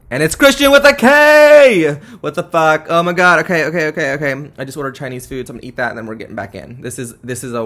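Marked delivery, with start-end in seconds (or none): 0.1-2.0 much higher, much louder excited scream; screaming the twist in shock; shocked shriek. Real, not acted. Shocked reveal of cliffhanger. 2.2-4.5 loud, high stunned exclamations; freaking out over the ending; shocked outburst. Real, not acted. Reacting to cliffhanger shock.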